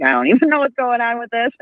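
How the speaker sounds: laughing through the words; half-laughing, baffled; laughing quote. Retold or imitated. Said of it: "I'm like".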